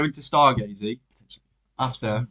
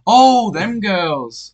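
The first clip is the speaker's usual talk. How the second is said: high, very slow drawn-out oh; mocking his realisation; 7 semitones higher, 7 dB louder, much slower than usual. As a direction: stretch it out like a dim penny dropping. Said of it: "he's like".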